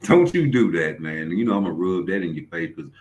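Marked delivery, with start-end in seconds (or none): none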